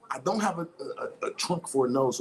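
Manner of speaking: ordinary delivery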